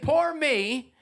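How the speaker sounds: high, self-pitying mock whine; mock whining. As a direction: whine it high, feeling sorry for yourself. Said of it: "while you're whining".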